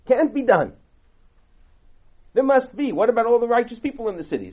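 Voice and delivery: Abraham protesting. Retold or imitated. Abraham arguing with God, loud indignant protest. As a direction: push back hard, outraged. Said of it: "Says Abraham".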